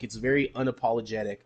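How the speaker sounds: ordinary delivery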